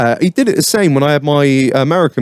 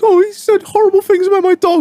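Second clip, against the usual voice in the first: much higher, mock wounded whine; imitating upset wife. The wife complaining about the kennel owner, high mock whine. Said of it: "She was like".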